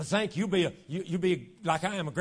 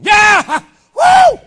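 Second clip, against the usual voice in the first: very loud, high whooping yell; excited fan cheer; 24 dB louder than usual. Acted. Preacher imitating Brother Reno at the ball game, excited whooping. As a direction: explode as if your team just scored. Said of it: "He got excited".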